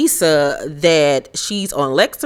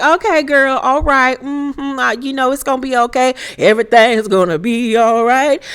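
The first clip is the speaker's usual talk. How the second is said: higher, placating reassurance; mock soothing; 6 semitones higher than usual. Kathy calming drunk Erica with higher, placating words. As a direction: humor the drunk friend, higher. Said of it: "Kathy's like".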